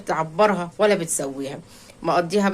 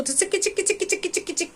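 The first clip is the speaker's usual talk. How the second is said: high rapid tut-tut clicking; mocking tongue clicks; 11 semitones higher, 5 dB quieter than usual. Real, not acted. (طقطقة لسان ساخرة)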